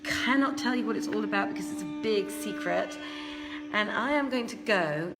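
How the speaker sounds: normal talking voice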